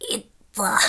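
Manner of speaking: strangled gasp breaking into a laugh; gasping for breath. Retold or imitated. Retelling how she couldn't breathe, a laughing gasp. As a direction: choke on the air, then laugh. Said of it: "みたいになっちゃって".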